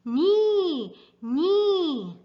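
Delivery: high whinnying nee; loud high horse call; horse neigh. Acted. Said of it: "Horses says".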